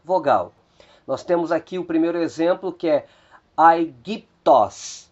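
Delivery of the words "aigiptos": "aigiptos" drawn out very slowly, syllable by syllable; very slow Greek demo. Shown as a demonstration. Teacher sounding out the word very slowly for students. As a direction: spell out "aigiptos" slowly with your voice. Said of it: "o acento recaiu exatamente sobre a segunda vogal".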